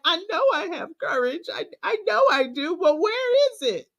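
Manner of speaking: half-reenacted tearful pep talk to herself; retold crying. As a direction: replay the crying self-talk, pitch lifted. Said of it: "I'm literally out there crying like".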